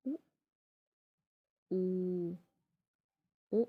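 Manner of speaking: उ, ऊ, ओ said one by one; rounded vowel demo. Shown as a demonstration. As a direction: model rounded vowels clearly for the class. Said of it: "circle बना रहे हैं ना".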